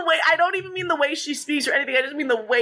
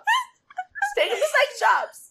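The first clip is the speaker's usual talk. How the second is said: laughing; 14 semitones higher than usual. Acted. Third time, high and laughing.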